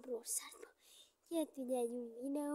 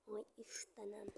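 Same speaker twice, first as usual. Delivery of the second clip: whispering; 5 semitones lower, 8 dB quieter than usual. Real, not acted.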